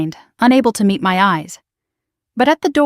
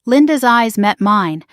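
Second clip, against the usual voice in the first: plain reading voice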